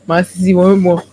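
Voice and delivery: a quick laugh, then the words come fast; reading a viewer's comment, laughing along. Retold or imitated. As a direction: chuckle through it as you read. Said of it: "he's laughing and saying".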